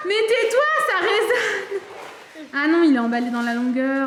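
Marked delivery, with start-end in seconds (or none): 0.1-1.8 giggling, high-pitched scolding; laughing. Real, not acted. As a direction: laugh it out while shushing. Laughing while telling partner off.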